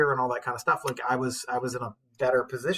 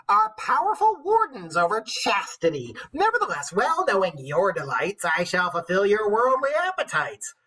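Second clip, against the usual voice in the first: put-on old wife reading; 7 semitones higher, 6 dB louder than usual. The old wife scolds her knight, high and loud. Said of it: "old wife's lines read aloud".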